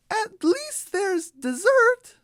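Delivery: very high, slow chick voice; squeaky cartoon chicken sighing; hopeful chick. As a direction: drag it out hopefully like a little chick. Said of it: "says Flower".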